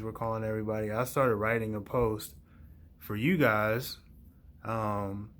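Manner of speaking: normal talking voice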